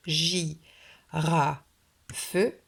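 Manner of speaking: "j, ra, feu" in three separate beats; split into syllables. Shown as a demonstration. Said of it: "le couper en trois syllabes".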